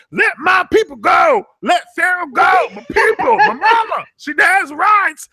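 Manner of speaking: high, loud hammed-up wailing; shrill fake-crying plea for her mama; mock performative shouting. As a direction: overact a protest for show. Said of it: "Livy's performing like".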